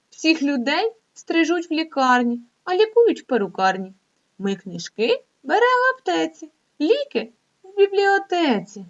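lively, sing-song poem reading; reading a poem to children. Acted. Teacher reading a silly poem to young pupils, lively. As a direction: read it like a playful rhyme for kids.

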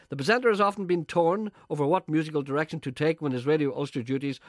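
tongue-in-cheek continuity announcer; announcer read. Acted. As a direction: read it like a pompous radio announcer. Scripted mock-grand link about presenter.

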